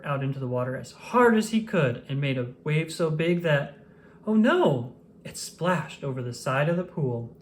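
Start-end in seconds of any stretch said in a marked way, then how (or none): none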